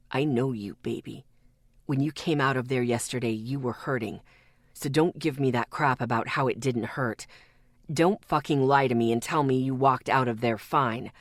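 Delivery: low, hard male voice; hard and quiet. A narrator's reading. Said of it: "His voice was hard as it grew quieter".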